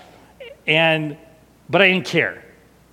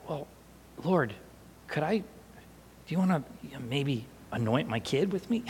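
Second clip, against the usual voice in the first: very soft, halting murmur; tentative, hushed asking; hesitant inner prayer; 11 dB quieter than usual. Retold or imitated. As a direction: think aloud shyly to God, feeling your way. Hesitant silent request to God.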